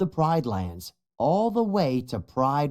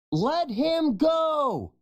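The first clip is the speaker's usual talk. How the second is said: high, slow shouted command; slower than usual. A narrator's reading. Young lion shouting at hyenas to free his friend. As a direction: yell it to free your friend. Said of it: "Kion shouts".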